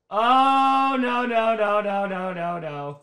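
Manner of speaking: long "oh no" sinking in pitch and volume; deflating, fading disbelief; dismayed groaning. Real, not acted. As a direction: let the no's tumble downward and die away. Reacts to an interception in dismay.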